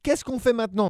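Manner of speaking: high, loud eager question; eager kid monster. Acted. Abominable asking his sister what's next, eager. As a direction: ask like an impatient kid. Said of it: "demanda Abominable".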